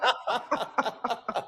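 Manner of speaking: high, giggly laughter; long high-pitched giggle; real laughing fit. Real, not acted. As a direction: crack up at your own story. Cracks up at his own story.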